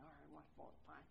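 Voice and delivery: sulky agreement to talk to God; grudging mutter. Retold or imitated. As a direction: grumble it under your breath. Grudgingly starts talking to God.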